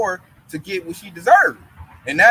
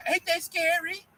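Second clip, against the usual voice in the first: higher, softer mock whine; mocking coworker; 9 semitones higher, 5 dB quieter, slower than usual. Mocking the coworker's fearful question, higher and softer. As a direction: mimic a timid coworker, pitched up. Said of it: "He talking about".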